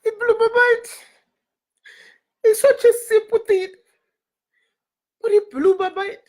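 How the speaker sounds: choked up, high-pitched crying voice; real tearful. Real, not acted. Moved speaker telling how Florida's simple thing blew her mind, tearful. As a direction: burst into tears mid-sentence. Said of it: "I'm crying over that banner".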